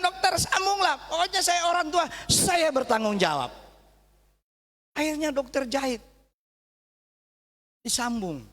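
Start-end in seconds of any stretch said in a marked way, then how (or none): 0.0-3.5 raised, pressing plea to the doctor; a father begging the doctor to reattach it; insisting parent. Retold or imitated. Father insisting the doctor sew it back, higher urgent plea. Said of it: "ketika itu saya bilang".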